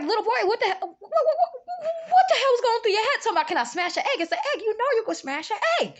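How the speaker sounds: high, squeaky mock scolding; laughing exasperation. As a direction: sputter at the boy through laughter. Retold exasperated scolding, half laughing.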